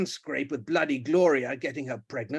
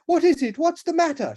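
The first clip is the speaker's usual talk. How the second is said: loud, much higher alarm; alarmed cry; 14 semitones higher, 7 dB louder than usual. Acted. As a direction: cry out in panic. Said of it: "she cried in alarm".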